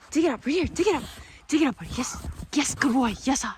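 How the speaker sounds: usual speaking voice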